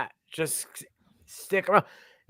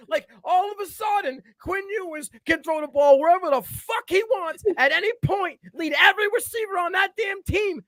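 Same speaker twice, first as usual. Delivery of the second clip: much higher, shouting it out; excited shout; 9 semitones higher than usual. Real, not acted. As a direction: rant like he can't believe it. Hyped rant about quarterback.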